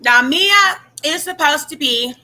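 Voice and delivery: talking through a mouthful of chips; chewing chips between words; crunching chips. Real, not acted. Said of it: "sorry, guys, I'm eating chips".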